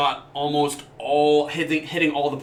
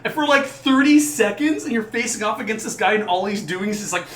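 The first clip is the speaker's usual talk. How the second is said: usual speaking voice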